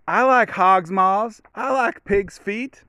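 high, slow, drawn-out movie-dad voice; Friday dad impression. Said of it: "his dad comes in".

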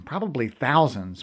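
ordinary delivery

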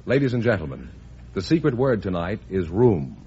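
ordinary delivery

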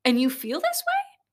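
rising to a high hammed-up pep shout; imitating Gary Vee. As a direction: hype speaker getting loud and high. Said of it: "He's like".

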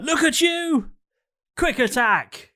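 high squeaky battle cry; high creature voice. Acted. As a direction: call the attack like a tiny beast. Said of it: "and he goes".

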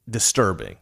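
ordinary talk

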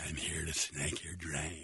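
low, slow, quiet snarl through a curled lip; hammed-up seductive plumber, lip snarling; mock sultry growl, slow and soft; snarled line. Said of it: "snarling my lip".

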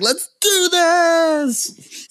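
high, very drawn-out stage holler; re-enacted pumped-up frontman shout; rallying yell. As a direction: stretch it into a battle cry.

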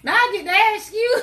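high, loud crow of triumph; squealed playful gotcha; gleeful taunt. Real, not acted. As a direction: crow it, breaking into laughter. Gleeful turning of tables.

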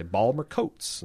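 Baltimore accent, "Balmer" in two syllables, "Coats" without the L; attempted Baltimore accent on Balmer Coats. Shown as a demonstration. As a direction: squeeze it into local Baltimore speech. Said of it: "that's the most accent I can get".

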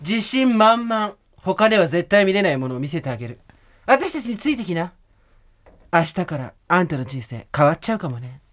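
strut it like a rock diva; 80s rock girl. Shown as a demonstration. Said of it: "八十年代のロック姉ちゃんから。".

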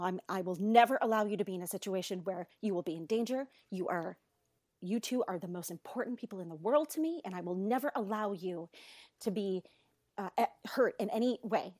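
calm explaining. Retold or imitated. Mother reassuring her kids in the car, calm. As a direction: explain it gently, keeping the voice down. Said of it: "trying to calmly explain".

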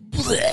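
long retching "bleh"; imitated vomiting. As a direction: mimic a violent heave.

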